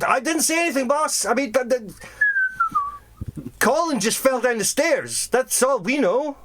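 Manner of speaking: high-pitched defensive henchman; guard making excuses. Acted. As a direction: protest innocence to the boss. Acted guards making excuses to boss.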